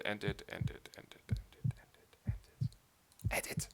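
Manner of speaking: whispered chant trailing off; hollow whispered repetition; fading whisper. Acted. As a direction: let the begging die into a whisper. Chanting despair fading away.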